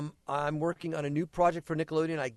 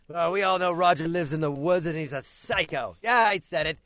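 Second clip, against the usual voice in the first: mock trash talk; 3 semitones higher, 7 dB louder than usual. Mock trash talk about absent colleague.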